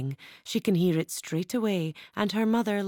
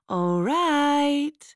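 higher, louder bright call; girl calling back gladly; cheerful child's shout; 8 semitones higher, 5 dB louder than usual. Acted. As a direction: answer happily from afar. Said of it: "The child shouts cheerfully".

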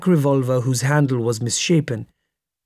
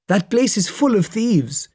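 markedly higher-pitched indignation; indignant accusation; 8 semitones higher than usual. A narrator's reading. Passenger complains to driver about bribe-taking officials, indignant. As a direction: accuse them in a raised pitch.

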